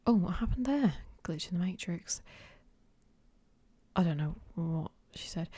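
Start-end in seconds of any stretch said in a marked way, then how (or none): none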